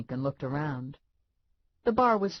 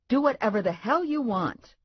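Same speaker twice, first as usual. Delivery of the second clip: irritated retort; 9 semitones higher, 4 dB louder than usual. A narrator's reading. Narrator snaps at Lula.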